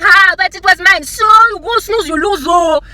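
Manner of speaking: high, loud, slow hammed-up whine; mock whining victim. Imitating a driver losing a spot, hammed-up whine. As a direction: whine like a wronged child. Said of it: "And you're like".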